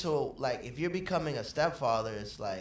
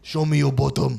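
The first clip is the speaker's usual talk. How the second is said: strict dad voice; 7 dB louder than usual. Retold or imitated. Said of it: "My dad used to be like".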